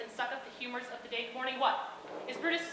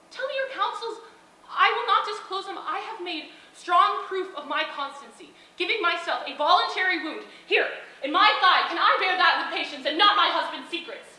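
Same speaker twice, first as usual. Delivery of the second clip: louder, building to a passionate cry; impassioned; 8 dB louder than usual. Acted. Portia showing Brutus her wounded thigh, impassioned and loud. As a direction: hurl it at him with fierce pride.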